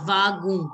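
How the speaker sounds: Vagu drawn out, no final N; silent N. Shown as a demonstration. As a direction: say Vagu slowly, stopping before any N. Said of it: "don't have to pronounce the N".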